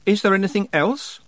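sharp, high-pitched question. A narrator's reading. Tiffany demands to know what else is expected, sharply. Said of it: "said Tiffany sharply".

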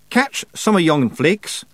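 high, slow dialect voice; Rob Anybody. Acted. Rob tells Tiffany to catch flakes, high slow dialect voice. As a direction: draw the words out high, in dialect.

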